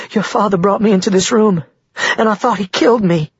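high-pitched, distressed woman's voice; anxious and pitched well up; frightened. A narrator's reading. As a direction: recount the attack, still shaken. Frightened woman tells of attack.

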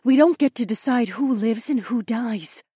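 high-pitched earnest plea; begging. A narrator's reading. Sister begs fighter to spare captured mage, pleading. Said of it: "Nokomis begged".

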